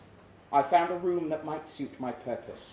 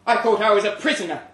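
much higher, much louder and fast; indignant exclamation; 10 semitones higher, 13 dB louder, faster than usual. Acted. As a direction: burst out in shocked protest. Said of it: "I exclaimed".

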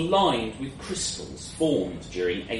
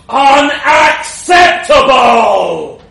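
very loud, high, drawn-out roar; booming dragon outburst, stretched right out; bellowing dragon; 13 semitones higher, 18 dB louder, much slower than usual. A narrator's reading. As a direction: roar the refusal as an enraged dragon. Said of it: "it bellowed".

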